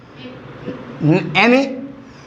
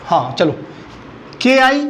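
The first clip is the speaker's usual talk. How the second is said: ordinary talk, nothing marked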